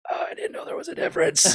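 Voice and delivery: high, whispery gasp of realization; mock wide-eyed whisper to oneself; whispered astonishment. Trainee in the back overhearing a checkride question, whispered astonishment. Voicing own shock at back of room.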